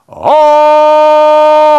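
long loud jungle yodel; Tarzan yell. Retold or imitated. Preacher imitating Tarzan for the congregation. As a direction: let out a booming jungle call.

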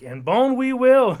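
loud, slow hammed-up movie line; slow, loud mock declaration; Simpsons quote. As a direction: boom it out like a cartoon quote. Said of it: "that's a Simpsons reference".

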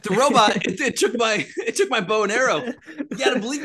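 high, loud hammed-up panic; exaggerated desperate excuse; mock frantic pleading. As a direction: plead your flimsy alibi through a laugh. Acting out Hawkeye's false excuse.

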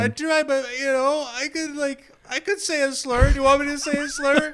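very high, loud mock rant; unhinged whiny outburst; hysterical.

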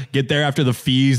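usual speaking voice

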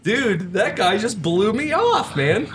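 ordinary talk

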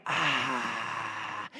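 low relieved sigh; contented dog sigh. Retold or imitated. Old dog settling in front of the heater, low contented sigh. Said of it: "She's like".

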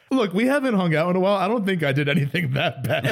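high, quick mock plea; a light imitation of pleading with a date. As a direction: rush it out like a guilty excuse. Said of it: "I was like".

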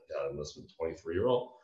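normal talking voice

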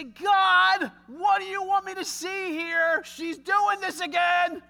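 high, loud exasperated outcry; angry complaint to God. Retold or imitated. Husband complaining to God about his wife, exasperated shout. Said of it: "and say".

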